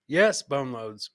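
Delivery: usual speaking voice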